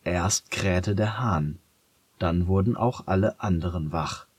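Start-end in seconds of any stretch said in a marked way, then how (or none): none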